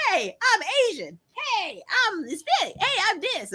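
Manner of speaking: high, sing-song mock hello; mock introductions. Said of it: "you're like".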